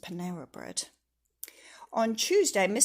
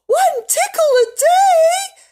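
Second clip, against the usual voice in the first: much higher, very loud, slow; shocked disbelief; 16 dB louder, slower than usual. Acted. Mister Tickle hears the condition, aghast. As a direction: gasp and protest in outrage.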